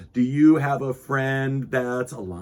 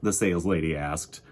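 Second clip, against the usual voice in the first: gentle storybook narration for little listeners; reading to children. A narrator's reading. Host reading Corduroy to young viewers, gentle storytelling. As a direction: read it like bedtime story time. Reading picture book aloud.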